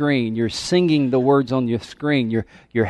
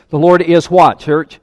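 call and response; 3 semitones higher, 7 dB louder, slower than usual. Acted.

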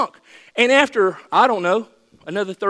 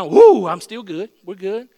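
sudden loud whoop, drawn out; mock cold-water whoop; 9 dB louder, slower than usual. Acting Peter hit by cold wave.

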